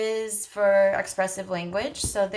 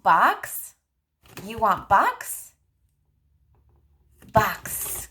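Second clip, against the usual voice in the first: put-on higher, slow and clear for a child; slow modeling voice; 3 semitones higher, 4 dB louder, much slower than usual. Shown as a demonstration. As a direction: stretch the words out for a toddler. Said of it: "So you hold on to the box".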